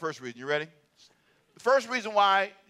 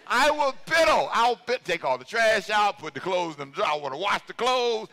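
normal talking voice

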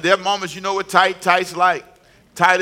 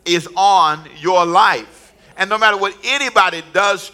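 preaching at full force; 3 semitones higher than usual. Acted.

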